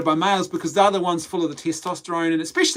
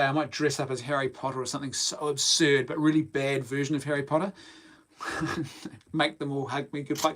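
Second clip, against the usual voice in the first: ordinary talk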